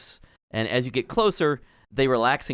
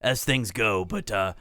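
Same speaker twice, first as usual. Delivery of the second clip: menacing character voice. Acted. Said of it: "Epilema says".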